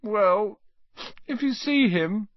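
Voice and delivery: high, woeful little voice; forlorn, pitched well up; miserable. A narrator's reading. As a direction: answer as a miserable boy. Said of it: "said the boy miserably".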